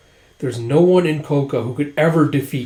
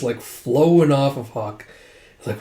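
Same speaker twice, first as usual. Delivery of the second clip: ordinary delivery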